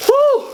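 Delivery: sharp high woo from the heat; high pained whoop. Real, not acted. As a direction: yelp high at the burn. Burning mouth from hot wings.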